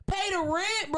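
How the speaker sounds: high, mocking pay-the-rent whine; mock demand. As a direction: parrot his demand in a high whiny voice.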